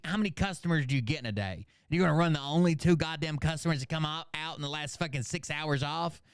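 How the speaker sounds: normal talking voice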